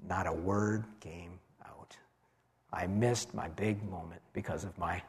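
breathless whisper, barely there. Acted. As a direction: let the voice vanish, mute. Struck mute before the crowd.

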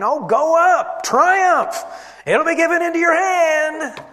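high, loud, mocking triumph; sarcastic prophet mocking the king with exaggerated enthusiasm; dripping sarcasm. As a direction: sneer it out as fake cheer. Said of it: "says with as much sarcasm as he can".

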